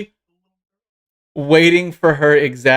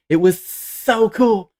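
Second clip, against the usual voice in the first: put-on high, long-drawn kid gush; awestruck little girl; 8 semitones higher, much slower than usual. Young fan gushes to her heroes, drawn out and awestruck. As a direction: stretch it out in pure fan awe.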